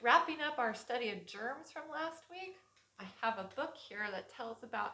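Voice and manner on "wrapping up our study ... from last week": ordinary delivery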